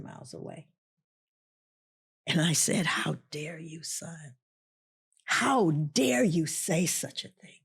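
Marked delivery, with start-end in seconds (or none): 2.9-7.6 slow, hushed motherly reproach; measured indignation, weighting each word; stern rebuke. Retold or imitated. Mother rebuking her despairing son.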